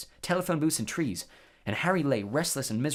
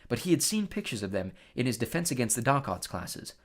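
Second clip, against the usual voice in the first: ordinary delivery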